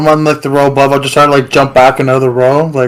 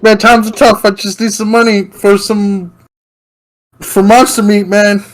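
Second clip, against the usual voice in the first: higher, whiny thief pleading poverty; 7 semitones higher than usual. Acted. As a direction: beg like a desperate hungry crook. Player voices pleading thief.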